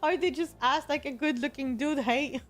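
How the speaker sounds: giggling through the words; laughing. Real, not acted. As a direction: laugh while you talk. Laughing at the handsome ad model.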